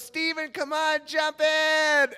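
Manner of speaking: high-pitched mock girl voice; girl calling out. Girl calling teen into the pool, high mock voice. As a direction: coax him in, pitched high.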